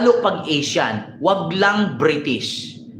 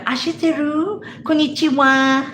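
much higher breathy-style mock Japanese; mock Japanese moaning; 7 semitones higher than usual. Imitating Japanese women moaning, high mock coo. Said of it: "di ba may sinasabi silang".

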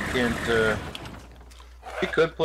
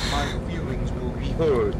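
sarcastic; 4 semitones higher than usual. Real, not acted. Mocking threat of YouTube comments.

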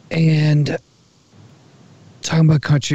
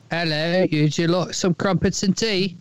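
higher-pitched mock English accent; 5 semitones higher than usual. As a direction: put on a caricature English accent. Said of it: "if you go to England".

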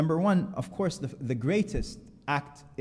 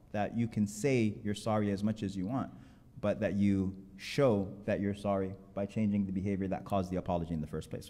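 usual speaking voice

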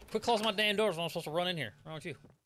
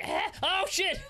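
mock monster yelp; 14 semitones higher, 4 dB louder, much slower than usual. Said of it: "he's like".

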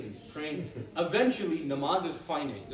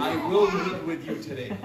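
laughing; 6 dB louder than usual. Acted. Prophet repeats his line, laughing.

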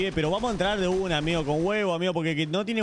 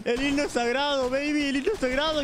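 high drawn-out triumphant cry; high, slow triumphant shout; hype exclamation; 10 semitones higher, slower than usual. Real, not acted. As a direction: announce it like an anthem.

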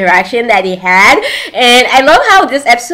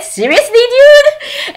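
high, rising incredulous squeal; mock disbelief; 14 semitones higher, slower than usual. Brother hears about the hundred over hundred, high-pitched mock disbelief. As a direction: react as the astonished brother, pitch shooting up.

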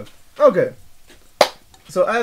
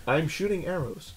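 soft, lower, deadpan archer line; underplay the hero boast; quiet mock hero; 4 semitones lower, 10 dB quieter than usual. Imitating Green Arrow again, soft deadpan archer voice. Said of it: "But look".

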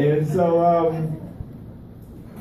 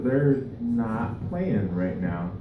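slow, low-key, sobered aside; hushed realization, acted out; suddenly serious; 4 semitones lower, 6 dB quieter, much slower than usual. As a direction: drop the volume and slow right down. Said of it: "it starts to get serious".

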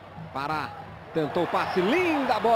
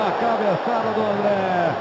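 usual speaking voice

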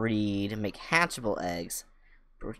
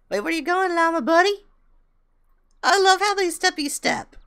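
cutesy pet voice; 8 dB louder than usual. Real, not acted. Baby-talking to llama.

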